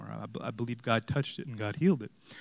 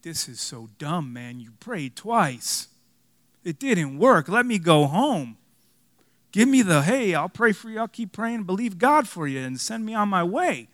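retold anger; 4 semitones higher, 7 dB louder than usual. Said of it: "and I'm angry".

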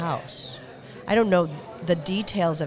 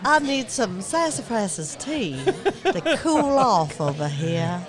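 mock Southern drawl; 3 semitones higher, 4 dB louder, slower than usual. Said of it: "do the whole broadcast in our accents".

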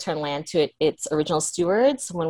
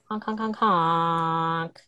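silly clown honks; much slower than usual. Pretend. Silly honking after intro.

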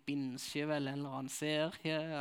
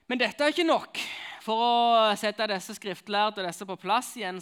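much higher and louder, slowing to a crawl; preaching at full force; 6 semitones higher, 13 dB louder than usual. Acted. Preacher building to the next parable, full-force preaching. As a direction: thunder it from the pulpit.

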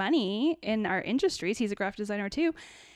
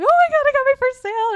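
much higher, louder and fast, squealing with joy; excited squeal; 10 dB louder, much faster than usual. Retold or imitated. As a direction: burst out in giddy disbelief. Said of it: "It was so exciting".

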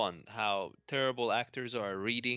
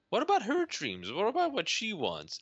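indignant retort; 10 semitones higher than usual. Retold or imitated. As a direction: fire back in outrage on her behalf. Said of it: "And I'm like".

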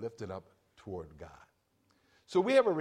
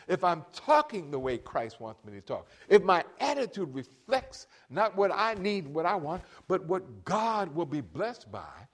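preaching build; 3 semitones higher, 4 dB louder than usual. Acted.

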